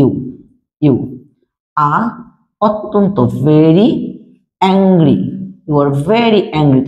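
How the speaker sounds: slow and deliberate, leaning on very; slow model sentence. Shown as a demonstration. Teacher slowly models the English sentence for pupils. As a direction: say it slowly so learners can copy. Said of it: "তুমি English কি?".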